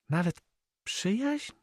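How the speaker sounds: put-on very high, anxious girl's question; growing anxiety. A narrator's reading. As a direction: climb in pitch as the worry grows. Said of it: "zapytała z rosnącym niepokojem". (rosnący niepokój w głosie)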